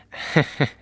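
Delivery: short bright laugh of a little bear; cub's giggle. Acted. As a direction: giggle like a delighted cub. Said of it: "Der kleine Bär gelacht". (kichernder kleiner Bär)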